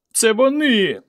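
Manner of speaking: high, drawn-out whine; whiny tearful voice. A narrator's reading. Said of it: "плаксивим голосом сказав завгосп".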